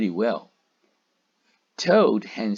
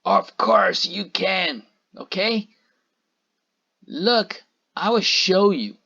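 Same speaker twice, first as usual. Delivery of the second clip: angry witch; 7 semitones higher, 4 dB louder than usual. A narrator's reading. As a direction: snap it out like a cross witch. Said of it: "said the witches angrily".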